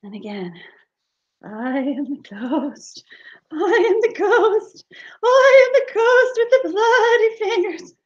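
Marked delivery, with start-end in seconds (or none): 1.4-7.9 slow, hollow ghost whisper rising high; whispered ghost wail. Acted. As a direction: breathe the haunting in a ghostly whisper. Ghost haunts sleeping guy again.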